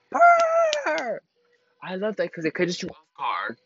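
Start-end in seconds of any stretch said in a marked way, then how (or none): 0.1-1.2 very high, loud trilled purr; high-pitched playful trill. Acted. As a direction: let out a squealed purr. Acts out her reaction.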